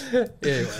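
ordinary talk, nothing marked

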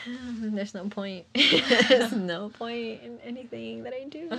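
slow, sighing defeat breaking into laughter; hammed-up hopeless lament; joking despair. Joking despair, sighs then laughs.

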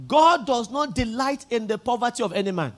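ordinary talk

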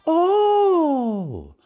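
mock new talking voice; silly high voice. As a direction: greet the audience in a goofy high voice. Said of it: "this is how I talk now".

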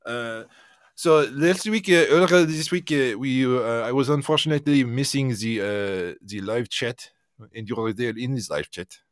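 put-on French accent. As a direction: speak English with a mock French accent. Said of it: "do your French accent".

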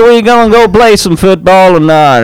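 fake Southern accent. Teen self mocked, put-on Southern accent at school. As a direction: play a boy faking a down-south accent. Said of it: "just talking real down south".

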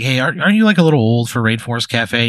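loud mocking jab; teasing friends. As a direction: call it out to tease him.

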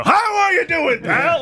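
high, shouted hail-fellow greeting; big hearty greeting. Acted. Imagining the actor entering a room, brash shout. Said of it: "come into a room and go".

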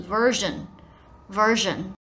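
slow, version with a voiced "zh"; native-speaker version. Shown as a demonstration. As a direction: say version twice slowly, voicing the middle. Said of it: "才是真的比较道地的念法".

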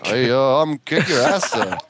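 mock threat in a Canadian lilt; Canadian accent. Shown as a demonstration. Canadian with a ripped jacket, Canadian accent. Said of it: "do a Canadian accent".

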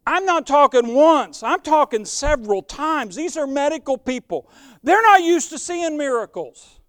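fervent sermon climax; rising preaching. Acted. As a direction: lift it up and drive it home to the church. Preaching builds again.

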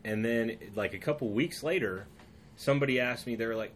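usual speaking voice

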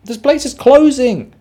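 high, loud whiny friend; friends' reply. Retold or imitated. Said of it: "And they go".

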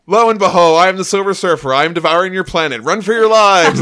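high, loud theatrical warning; grand, shouted herald of doom; booming proclamation. Acted. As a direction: bellow it like an old-school villain. Surfer acting old-school planet devourer.

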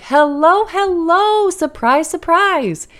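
put-on high, singsong hello to an early baby; surprised greeting. Mother greeting her early baby, high and surprised.

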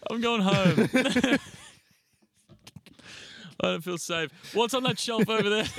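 high, loud, laughing protest; laughing mock alarm. Real, not acted. Co-host pretending to feel unsafe, high laughing alarm. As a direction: protest loudly as the laughter takes over.

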